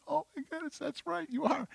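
high, very soft squeak; overcome in prayer. Retold or imitated. Worshipper to merciful God, overcome, in a tiny high voice. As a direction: let it out small and high, as if choked up. Acting own tearful realisation in prayer.

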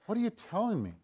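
lightly acted questioning, pitched well up; questioning Satan. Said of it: "you look at Satan going".

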